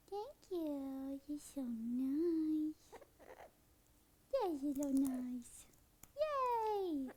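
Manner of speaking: soft, very slow sweet praise; tender drawn-out pet talk; gentle praise. Real, not acted. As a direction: coo thanks to the parrot. Praises the bird.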